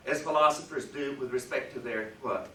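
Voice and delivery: usual speaking voice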